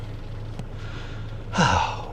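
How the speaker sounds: long, soft breathy exhale; long relieved sigh. Real, not acted. Vlogger climbs back in from the wind, long sigh. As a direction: let out a big weary breath.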